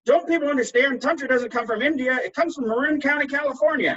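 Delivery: high, loud and indignant; mock table-banging rant. Dinner guest ranting at the host, high and loud. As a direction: hammer it home like a self-styled tantrika. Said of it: "started banging on the table and saying".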